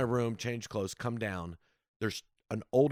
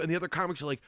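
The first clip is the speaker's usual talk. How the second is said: ordinary delivery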